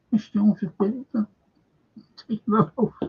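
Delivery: a hint of his voice; imitating him. Retelling what he said to the lads, mock retort. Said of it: "He said".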